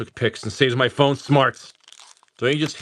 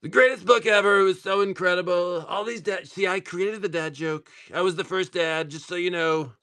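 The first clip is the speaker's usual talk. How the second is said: higher-pitched put-on boastful voice; mock braggart; 8 semitones higher than usual. Dad jokingly crowing about his dad jokes, mock boasting. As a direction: brag in a hammed-up high voice.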